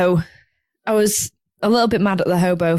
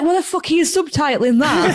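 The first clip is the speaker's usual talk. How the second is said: mock indignation; 8 semitones higher than usual. Retelling reaction to needless subtitles.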